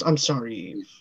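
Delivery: low, soft, falling away; mock apology. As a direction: quietly apologize as the husband, voice dropped. Acted apology to wife.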